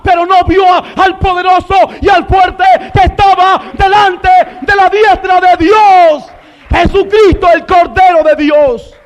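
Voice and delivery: high, loud, mounting list that peaks in a shout; full-force preaching. Real, not acted. Preacher listing the weak men Satan saw, full-force preaching. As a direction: hammer each name, building to a roaring climax.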